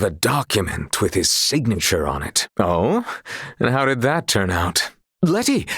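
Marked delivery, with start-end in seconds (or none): 5.2-5.7 sharp, much higher cry of her name; startled cry of the name, much higher; startled call. Acted. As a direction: blurt her name in alarm. Flustered, cutting a friend off.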